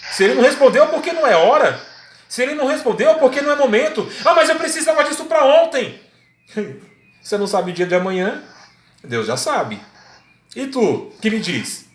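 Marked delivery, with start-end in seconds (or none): 4.2-6.0 high-pitched mock complaint; impatient protest. Believer tells the preacher it was needed yesterday, impatient protest. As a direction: push back urgently, pitched well up.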